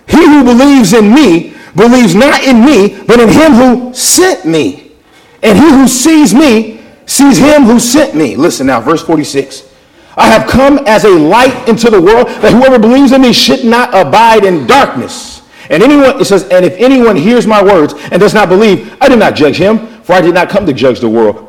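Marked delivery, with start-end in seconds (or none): 0.1-8.4 loud, high, slow proclamation; crying out. Acted. Preacher reads Jesus' words to the people, crying out. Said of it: "he cried out, listen now". 10.2-21.5 raised, high scripture proclamation; crying out. Acted. Preacher reads Jesus' light-into-the-world saying, crying out. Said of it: "he cried out, listen now".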